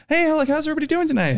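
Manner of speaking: high, quick put-on server greeting; imitated high-pitched server patter; server's greeting. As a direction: greet a table in customer-service voice. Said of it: "I go up and I'm like".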